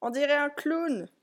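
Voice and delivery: high-pitched exclamation; cried out. A narrator's reading. Clown reacts to the snowman, exclaiming high. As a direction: exclaim it in a lifted voice. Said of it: "s'écria-t-il".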